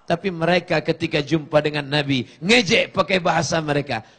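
ordinary talk

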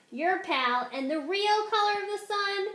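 plain reading voice

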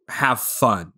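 ordinary talk, nothing marked

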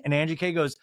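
ordinary talk

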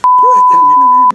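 strangled high curse from the spice; mouth burning from spicy food. Real, not acted. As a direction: swear through a mouth on fire. Eating very spicy noodles.

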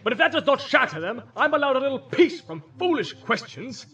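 loud, high-pitched scolding; irate old wizard, voice right up; exasperated wizard. Acted. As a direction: tell off a pestering hobbit. Wizard continues scolding the hobbit.

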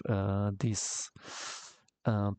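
usual speaking voice

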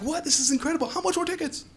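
high-pitched, excited disbelief; eager, high-pitched questioning; mock amazement. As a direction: react like a thrilled fan. Dad thrilled by son's cafeteria show.

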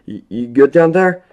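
anxious shout down the stairwell; shouted call. A narrator's reading. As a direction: yell down, a bit shaken. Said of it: "Will shouted from his cell".

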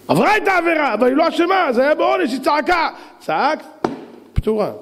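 high-pitched, urgent argument; worked-up preacher's cry; impassioned pleading. Acted. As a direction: plead her innocence to the crowd. Preacher argues the girl's innocence loudly.

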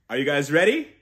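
normal talking voice

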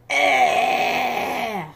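short, loud rude yelp; rude shout. Acted. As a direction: blurt it like a naughty dinosaur. Dinosaur making a rude noise.

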